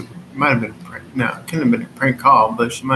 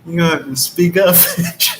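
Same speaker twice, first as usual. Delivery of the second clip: fast, louder, through laughter; laughing demand; 3 semitones higher, 4 dB louder than usual. Real, not acted. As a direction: bark it out while cracking up.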